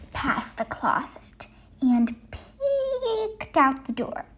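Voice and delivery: sneaky whisper. Acted. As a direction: tiptoe the words past the closet. Sneaking past the closet.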